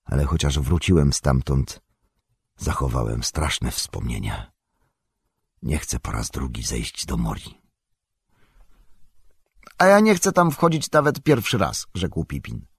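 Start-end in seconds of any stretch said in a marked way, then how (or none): 0.1-7.6 a lightly voiced ranger recalling dark halls; Aragorn. A narrator's reading. Aragorn voiced low and grave. 9.8-11.9 high, eager hobbit voice; young hobbit. A narrator's reading.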